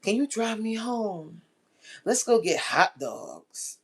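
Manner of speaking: slow, lightly voiced flirt; a hint of a flirty coworker. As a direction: ask him sweetly, taking it slow. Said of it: "And she's like".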